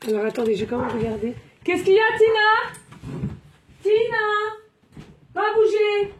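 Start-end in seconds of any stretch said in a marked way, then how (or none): 1.6-6.1 high-pitched call, name held out; calling the dog. Real, not acted. Owner checking on her dog Tina, high calling. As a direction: call out high to a pet across the room.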